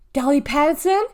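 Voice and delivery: loud, high summons by full name; shouted. A narrator's reading. Cashier storms out after the girl, shouting. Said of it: "she shouted".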